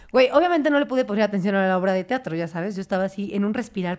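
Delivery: ordinary talk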